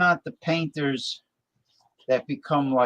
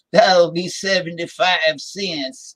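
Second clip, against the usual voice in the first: barber's drawl; 4 semitones higher, 6 dB louder, slower than usual. Retold or imitated. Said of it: "The guy was like".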